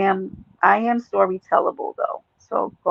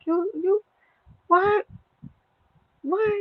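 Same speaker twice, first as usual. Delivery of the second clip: squeaky high disbelieving you, what; hammed-up high-pitched shock; mock outrage; 11 semitones higher, 5 dB quieter, slower than usual. Streamer faking outrage at chat, high mock disbelief.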